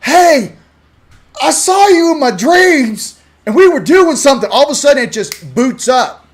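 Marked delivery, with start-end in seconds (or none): 0.0-4.5 high, loud, amazed mom voice; a mom blurting out her strange dream, raised and loud; mom's surprise. Pretend. Mom telling her kid about a dream, high loud amazement. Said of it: "your mom will go".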